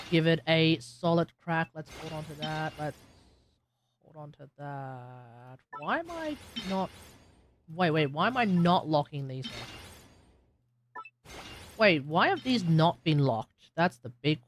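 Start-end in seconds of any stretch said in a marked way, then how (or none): none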